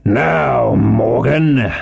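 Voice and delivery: drawn-out, measured warning; stern landlord calling a man to account. A narrator's reading. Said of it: "said Long John very sternly".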